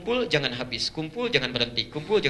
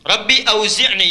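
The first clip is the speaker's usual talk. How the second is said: slow, raised chanting of the prayer; prayer recitation; 6 semitones higher, 8 dB louder, slower than usual. Acted. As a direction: lift the voice in supplication.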